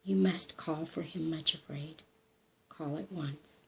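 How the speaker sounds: soft, low, urgent coaxing; gentle but urgent. A narrator's reading. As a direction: press her gently to act now. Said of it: "very gently but urgently".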